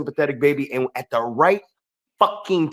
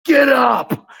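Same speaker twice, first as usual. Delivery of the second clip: higher, louder barked Get up; yelling; 10 semitones higher, 5 dB louder than usual. Retold or imitated. Wrestler yelling at the heel to get up. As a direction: yell at the downed opponent. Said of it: "he yells at the heel".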